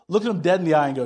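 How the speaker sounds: ordinary delivery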